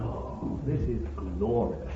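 very soft, low, breath-held wonder; hushed awe. Retold or imitated. Preacher acts out old Paul stopping mid-writing, hushed with awe. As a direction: drop almost to a whisper in awe. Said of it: "he stops and says".

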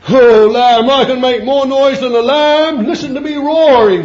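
very high, loud mock lion boast; boastful lion. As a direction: crow like a proud roaring lion. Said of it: "says the lion".

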